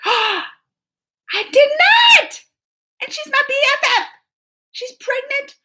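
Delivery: gasp, then a high, fast "did not!"; stunned disbelief. Real, not acted. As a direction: caught off guard by the news. Shocked news about her friend.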